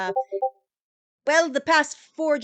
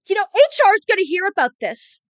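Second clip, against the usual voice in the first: higher, louder, fast indignant shout through the door; frustrated threat; 7 semitones higher, 8 dB louder, faster than usual. Acted. Rival shouts through the closed door, furious and fast. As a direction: storm off yelling a threat. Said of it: "quite frustrated".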